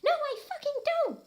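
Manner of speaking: high, loud denial; shout. Acted. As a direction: snap it back hard. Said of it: "I shouted".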